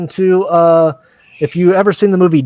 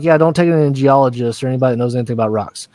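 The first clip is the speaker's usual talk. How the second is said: rushed aside; 4 semitones lower, much faster than usual. Retold or imitated. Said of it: "he's like".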